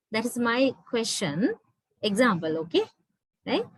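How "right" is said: ordinary delivery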